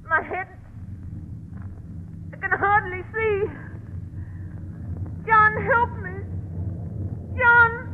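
desperate high cries for help; rising panic. Acted. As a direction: plead as you lose yourself. Wife transforming, panicking.

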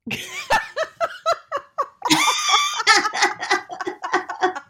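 long, high laughing fit; real helpless laughter. Real, not acted. Teller recalling the clerk's awkward reply, long high laughing fit. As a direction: crack up for several breaths.

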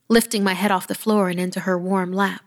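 ordinary delivery

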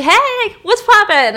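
high, loud, breezy greeting; carefree captain. Retold or imitated. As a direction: imitate an oblivious captain popping in. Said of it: "looks like he's just there for lunch".

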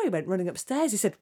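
plain reading voice

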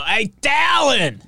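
high heckler's holler, name drawn out; mock a clueless fan yelling from the stands; dumb Yankee fan. Host mocks dumb fans getting the pitcher's name wrong, hollering. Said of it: "you'll hear the dumb Yankee fans go".

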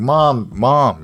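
high, loud retold cry for mom; imitating a panicked kid calling. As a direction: shout for mom through the door. Banging on door, calling mom.

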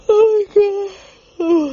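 said through a big yawn. Real, not acted. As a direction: stretch it out in a yawn. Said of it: "it's a big yawn".

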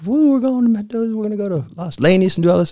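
high, bright whoop of a hyped traveller; giddy mock cheer as the trip begins; excited whoop. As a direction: sell the thrill of a wine trip. Excited friends heading to Mendoza.

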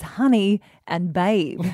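ordinary talk

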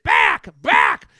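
birdlike squawks of back; squawking birds. Retold or imitated. Crowd in line imitated as squawking birds.

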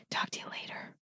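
soft whispered goodbye; whispering. Acted. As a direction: whisper the sign-off. Whispered sign-off.

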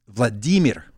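Vladimir as vla-DEE-mir, middle syllable stressed; Russian stress. Shown as a demonstration. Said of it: "It's Vladimir".

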